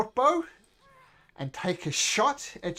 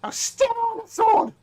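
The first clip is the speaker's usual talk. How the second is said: high, loud goblin outburst; frustrated goblin shriek; annoyed goblin; 9 dB louder than usual. Acted. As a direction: yell it like a thwarted little goblin. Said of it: "And the goblin says".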